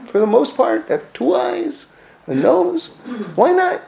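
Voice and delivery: incredulous questioner. Retold or imitated. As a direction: marvel at the order of the world. Voicing someone's questions about creation.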